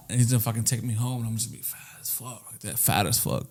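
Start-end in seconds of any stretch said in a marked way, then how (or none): none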